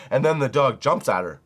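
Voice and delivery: ordinary talk, nothing marked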